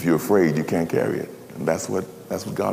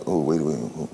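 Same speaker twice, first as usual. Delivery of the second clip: lower, quieter stunned stop; shocked halt; 7 semitones lower, 4 dB quieter than usual. Acted. Minister hearing of the affair, quietly stunned. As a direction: pull up short in disbelief.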